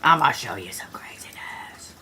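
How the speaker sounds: low, soft conspiratorial whisper; teasing whisper. Real, not acted. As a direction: whisper it as a tease before a big reveal. Hushed tease before the reveal.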